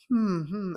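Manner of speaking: high murmured hums of agreement; mock congregation's thoughtful hum; imitated reflective hum. Congregation after the speaker's big comment, mock reflective hum. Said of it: "she makes this big comment".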